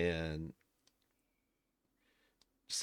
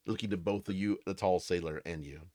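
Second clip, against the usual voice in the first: ordinary talk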